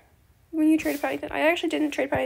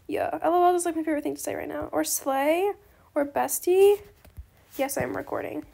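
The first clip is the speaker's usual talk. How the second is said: ordinary talk, nothing marked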